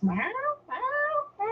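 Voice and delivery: a hint of a cat's plaintive meow; imitating cat meows. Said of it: "And she'll do this".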